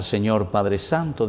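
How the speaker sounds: ordinary talk, nothing marked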